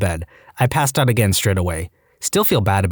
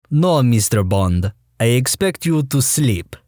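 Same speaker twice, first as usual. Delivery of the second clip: Bond villain; slower than usual. Retold or imitated. Dentist's villain reply quoted.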